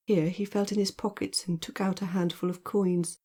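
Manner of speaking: ordinary delivery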